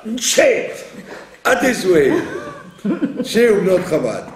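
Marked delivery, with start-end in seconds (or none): 0.1-0.4 Nche said with the vowel swallowed, laughing; lazy Nche. Shown as a demonstration. Teacher mocking the lazy Nche, laughing. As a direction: clip Nche short with a laugh. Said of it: "pour vous faire".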